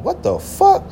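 sudden high squeak of disbelief; shocked what the fuck. Retold or imitated. After she hangs up on him, a high startled outburst. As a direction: yelp it out, caught off guard.

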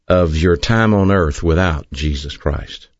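ordinary delivery

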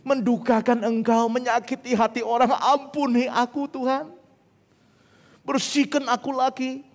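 high, pleading prayer voice; acted bedtime prayer. Believer confessing to God before sleep, high and pleading. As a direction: plead earnestly, pitched high. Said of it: "dia akan berdoa".

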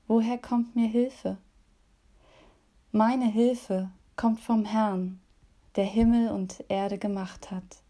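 solemn, measured scripture reading; psalm reading. Acted. Reads Psalm 121.

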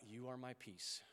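soft and slow, on the out-breath; breathed prayer. Shown as a demonstration. A pastor showing the church how to pray while breathing, soft and slow. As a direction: let it fall out slowly and softly. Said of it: "And then we breathe out".

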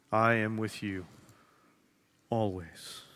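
Jesus comforting you, hushed and tender. Pretend. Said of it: "hear Jesus looking at you saying".

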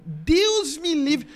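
a horrified, dragged-out "Deus me livre"; drawn-out refusal. Retold or imitated. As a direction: stretch out "Deus me livre" in mock horror. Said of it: "eu fazia assim".

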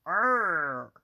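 loud frustrated growl; mock growl. Fed up with some people, loud mock growl. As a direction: let out a loud angry growl.

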